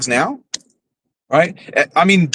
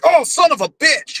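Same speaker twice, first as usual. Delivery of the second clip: much higher mock cry of pain; imitated stubbed-toe yelp; 12 semitones higher than usual. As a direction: curse as if a toe just got stubbed. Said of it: "he'll stub his toe and he's like".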